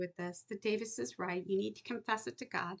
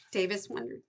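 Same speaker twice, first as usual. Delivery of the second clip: ordinary delivery, nothing marked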